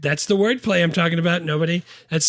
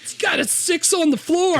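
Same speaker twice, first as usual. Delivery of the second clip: mock car guy. Mechanic bit continued.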